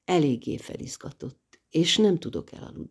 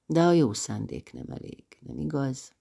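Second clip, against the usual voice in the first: usual speaking voice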